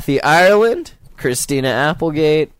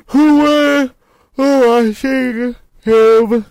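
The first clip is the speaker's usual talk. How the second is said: talking through a fake yawn, higher; yawn sigh; 7 semitones higher, 3 dB louder, much slower than usual. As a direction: stretch the words into a mock yawn. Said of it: "It's my yawning impression".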